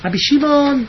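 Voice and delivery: restrained radio-host voice; קול של מנחה רדיו. Retold or imitated. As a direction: say the name smoothly, like a radio presenter. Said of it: "הוא ככה קול כזה של מנחי רדיו". (קול מאופק של קריין רדיו)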